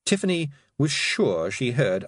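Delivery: usual speaking voice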